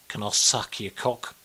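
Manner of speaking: put-on low, soft, slow drunken ask; low drunk come-on. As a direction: murmur it like a swaying drunk. Imitating drunk Aussie come-on.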